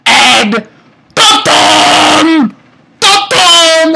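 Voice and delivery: high, booming dramatic sting; loud scream. Real, not acted. Screaming a suspense sting to viewers, very loud. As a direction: belt it out like a dramatic cue. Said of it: "I screamed so loudly".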